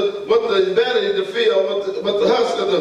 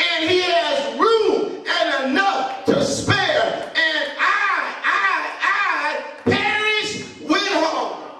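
much higher, pleading, slow; rising anguished preacher's voice; prodigal son's cry; 5 semitones higher than usual. Acted. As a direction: cry out how hungry he is. Son quoting his realisation.

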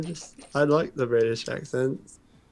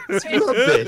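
high, loud laughing British voice; 11 semitones higher, 7 dB louder, slower than usual. Pretend. Laughing mock British word.

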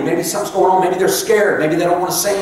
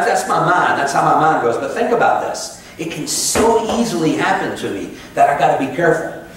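usual speaking voice

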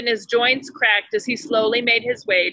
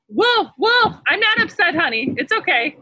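put-on boy crying wolf; 8 semitones higher than usual. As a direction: shout the alarm as the boy.

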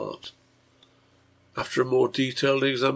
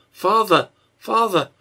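high, loud cry for a parent; calling out; 9 semitones higher, 7 dB louder than usual. A narrator's reading. Sick child calls for her father, calling out. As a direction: call out urgently, pitch raised and falling.

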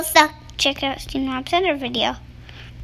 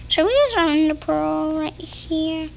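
normal talking voice

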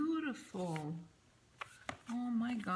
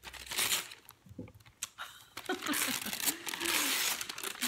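high airy giggle of delight; breathy delighted laugh; 9 semitones higher than usual. Real, not acted. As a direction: let out a long giddy laugh. Delighted at the gift.

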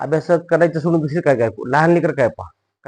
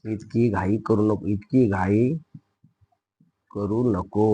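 softer, word-by-word repeat; slow dictation; 5 semitones lower, 6 dB quieter, slower than usual. Shown as a demonstration. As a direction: spell it out slowly for pupils copying. Teacher repeats slowly for dictation. (सावकाश, हळू आवाजात शब्द सांगणे)

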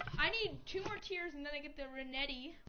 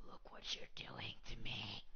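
whispering; 7 dB quieter than usual. Pretend. Mock hurt whisper.

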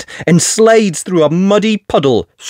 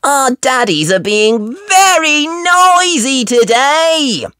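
put-on high, slow little girl voice; a small piggy complaining; Peppa piglet voice; 13 semitones higher, slower than usual. Peppa complaining to friends about the noisy daddies.

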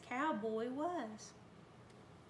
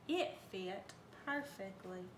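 ordinary delivery

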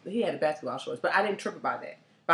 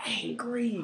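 whispered word; 4 semitones higher, 6 dB quieter than usual. Real, not acted. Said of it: "He was angry".

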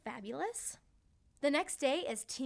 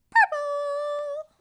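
excited; 13 dB louder than usual. Acted. Storyteller exclaims the colour.

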